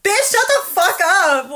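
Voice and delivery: high, drawn-out mock yell; retold angry retort. Driver retells cursing through open window, heated and drawn out. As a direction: curse slowly at the other car. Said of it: "just had the windows down".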